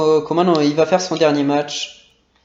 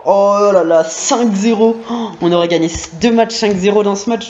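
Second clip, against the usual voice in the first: excited; 4 semitones higher, 4 dB louder than usual. Real, not acted. Celebrating fifth goal.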